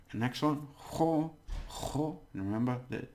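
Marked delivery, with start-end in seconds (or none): 0.9-2.2 jo as a higher, rasped "kho"; throaty Spanish J. Shown as a demonstration. Teacher demonstrating the J for learners, throaty "kho". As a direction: scrape the J of jo in the throat. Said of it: "the J has to be".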